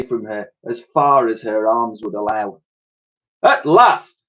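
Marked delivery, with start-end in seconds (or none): 3.4-4.2 high, loud triumphant cry; sergeant calling out. A narrator's reading. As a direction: shout it across the grounds. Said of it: "called out the sergeant major".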